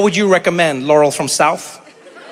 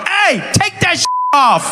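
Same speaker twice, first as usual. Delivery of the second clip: much higher, slow hammed-up yell; mock shout; 11 semitones higher, slower than usual. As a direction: bellow an order at the bathers. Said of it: "I would have been there tomorrow like".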